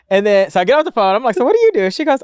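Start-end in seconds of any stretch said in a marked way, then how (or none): 1.4-1.9 very high squeaky question; high-pitched prying. Retold or imitated. Asking the lady in the next seat, high prying squeal. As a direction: ask it way up high, playing the nosy seatmate.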